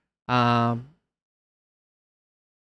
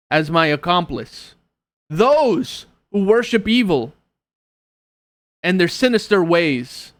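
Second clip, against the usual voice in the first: raised, ringing declaration to the room; proud noblewoman's proclamation; 5 semitones higher, 5 dB louder than usual. Acted. Isadora vows to the tavern crowd to crush evil, ringing proclamation. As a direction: proclaim it like a returning champion.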